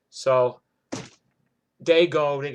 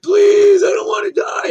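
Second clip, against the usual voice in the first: shrieking high, loud, crying plea; mock terrified screaming; 16 dB louder, much slower than usual. Jersey guy begs the armed cops not to kill him, shrieking and crying. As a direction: wail it like a terrified coward begging for his life. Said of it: "Mister New Jersey starts screaming".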